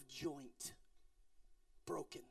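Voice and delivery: trailing whisper. Real, not acted. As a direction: let the words fall to a whisper. Dramatic sermon climax.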